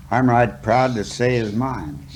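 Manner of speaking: usual speaking voice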